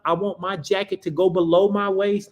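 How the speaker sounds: ordinary talk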